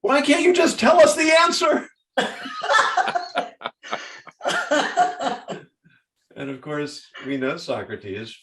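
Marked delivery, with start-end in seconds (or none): 0.0-1.9 high, loud exasperated student whine; imitating a frustrated student, much higher and louder. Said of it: "got enormously frustrated". 2.2-5.6 big laugh. Real, not acted. Laughing at own story.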